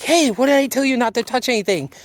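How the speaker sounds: high, fast mock scolding; imitated yell. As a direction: snap at her like a grumpy host. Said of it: "he yells at Ellie".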